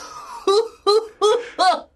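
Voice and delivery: high, repeated hooting laugh; high gloating cackle; gleeful fox laugh. A narrator's reading. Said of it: "he laughed and laughed".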